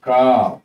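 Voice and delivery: "কাপ" with a long open aa; loud "কাপ", vowel stretched; drawn-out a. Shown as a demonstration. Teacher modelling u said as a, drawn-out "কাপ". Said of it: "আমরা টেনে উচ্চারণ করি".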